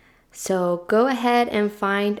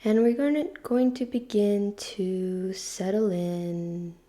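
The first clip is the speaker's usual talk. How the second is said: guided meditation; 3 dB quieter than usual. Real, not acted. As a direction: ease them gently into stillness, unhurried and hushed.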